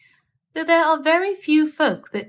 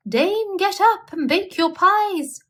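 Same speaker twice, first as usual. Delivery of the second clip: high, bright rooster cry; crowing cocks; 8 semitones higher than usual. A narrator's reading. Cocks crowing at the dame to wake up, high calling. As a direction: call it out like a cockerel waking the house. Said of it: "the cocks cried out".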